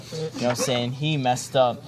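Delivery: normal talking voice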